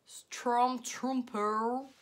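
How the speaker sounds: Stormtrooper said slowly in a put-on English accent. Real, not acted. Vlogger reading a shade name in a playful English accent. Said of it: "On adore mon accent".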